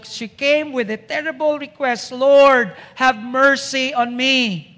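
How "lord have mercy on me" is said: loud cry of Lord, then slow begging; pleading. Acted. Woman kneeling before the Lord begs loudly for mercy. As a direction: beg on your knees, crying out the first word. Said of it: "So she's pleading".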